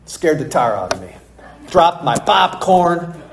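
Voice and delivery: usual speaking voice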